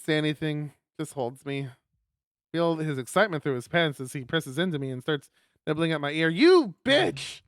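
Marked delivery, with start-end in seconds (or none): none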